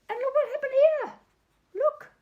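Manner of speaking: excited. Real, not acted. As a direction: gasp out the surprise on the page. Excited at the page turn.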